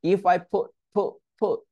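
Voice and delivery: "put" cut off before the T releases; stopped T. Shown as a demonstration. Teacher drilling the stop T, stopped T. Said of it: "stop টি করতে পারবেন".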